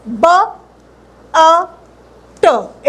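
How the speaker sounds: ब, अ, ट said one by one with pauses; sounding out. Shown as a demonstration. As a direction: break the word into ब, अ, ट. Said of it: "ऐसे करके पढ़ना सीखते हैं".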